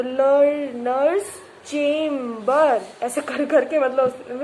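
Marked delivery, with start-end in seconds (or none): 0.0-2.8 learners chamber broken into slow syllables; slow syllable-split learners chamber. Shown as a demonstration. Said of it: "ऐसे बोला उसने".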